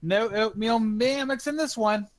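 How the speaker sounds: high-pitched yell, rising through the line; yelled announcement. Acted. Gilbert after a great belch, yelled announcement. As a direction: holler it out to the whole room. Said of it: "just yells out the statement".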